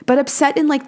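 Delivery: usual speaking voice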